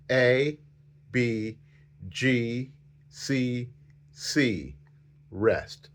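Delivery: slow, evenly spaced letters, softly held; name each note slowly with a pause after it; slow note naming. Shown as a demonstration. Flute teacher saying the notes with students, slowly. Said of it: "So let's say them slowly".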